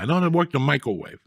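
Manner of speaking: normal talking voice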